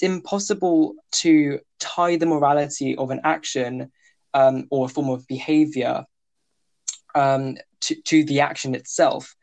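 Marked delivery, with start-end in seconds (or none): none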